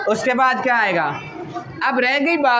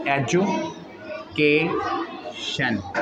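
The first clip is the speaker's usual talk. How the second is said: drawn out syllable by syllable; 6 semitones lower, 6 dB quieter, much slower than usual. Shown as a demonstration. Teacher to class, slowly sounding out education syllable by syllable. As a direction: say education very slowly, split into ए-जु-के-शन, softer. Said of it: "शन ये complete voice है".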